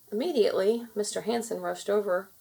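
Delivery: ordinary delivery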